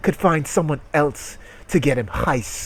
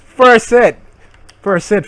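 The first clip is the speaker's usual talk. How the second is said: much higher and louder, thrilled; excited exclaim; 8 semitones higher, 11 dB louder than usual. Real, not acted. Streamer finding the product online, loud excited cheer. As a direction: cheer the first search hit.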